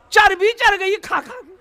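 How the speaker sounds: high-pitched mock outrage; angry villager. Angry villager berating the muezzin. (गुस्साए आदमी की ऊँची नक़ल)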